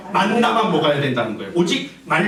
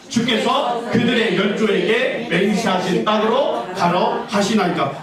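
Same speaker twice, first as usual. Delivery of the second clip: 함께 성경 봉독. Shown as a demonstration. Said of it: "나 맨 마지막에 시작".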